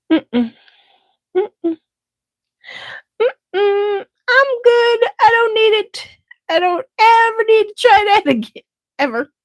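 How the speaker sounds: high, put-on diva no thanks; sing-song dismissal of the price; sassy refusal. Refusing the pricey perfume, high diva voice.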